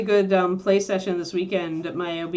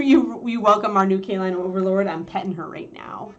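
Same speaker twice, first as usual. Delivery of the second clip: ordinary talk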